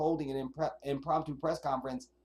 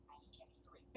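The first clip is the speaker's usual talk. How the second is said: whisper; 19 dB quieter than usual. Real, not acted. Muttering while adjusting volume.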